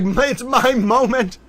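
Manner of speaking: high, slow, airy voice for a pretend towel; Jay Towel voice. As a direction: stretch it out like a proud little towel. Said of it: "Jay Towel's like".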